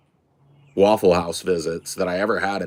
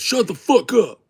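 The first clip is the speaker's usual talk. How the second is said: high, loud retold outburst; angry shout; 11 semitones higher, 5 dB louder than usual. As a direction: blow up at a nagging coworker. Retold cook snaps at waitress.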